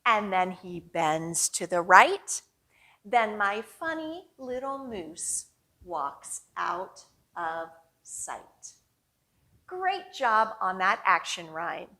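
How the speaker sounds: ordinary talk, nothing marked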